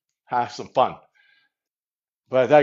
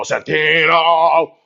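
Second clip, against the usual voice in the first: long drawn-out, louder name call; imitated adoring call; 2 semitones higher, 5 dB louder, much slower than usual. Imitating girls who loved Santino, long drawn-out call. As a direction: stretch the name out like a swooning call. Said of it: "they used to go".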